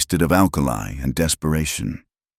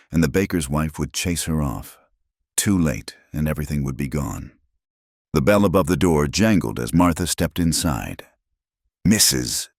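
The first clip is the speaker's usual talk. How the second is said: plain reading voice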